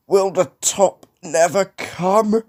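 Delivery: slow, high, effortful plea; straining. A narrator's reading. James thinking on the steep hill, straining. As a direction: drag it out as if labouring uphill.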